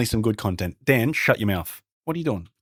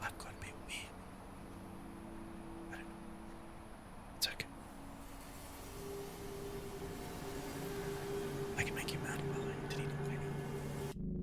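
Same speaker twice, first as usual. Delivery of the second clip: hushed whisper so the monster can't hear; 23 dB quieter, faster than usual. Real, not acted. Hiding from the game monster, whispering to viewers. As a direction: breathe the words, barely voiced. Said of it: "I just have to speak really quietly".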